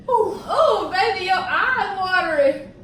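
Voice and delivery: gasping laugh, then teasing. Real, not acted. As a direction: laugh out loud at the teary partner. Laughing at partner's spicy tears.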